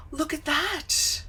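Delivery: high, drawn-out delighted marvel; amazed. Real, not acted. Streamer marvels at the new app feature, amazed. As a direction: show off the discovery, pitched high and slow.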